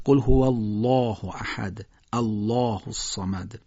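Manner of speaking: deliberate recitation with thick, dark "الله"; measured Quranic tafkhim demonstration; heavy lam recitation. Shown as a demonstration. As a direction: recite "الله" full-mouthed with a long back "aa".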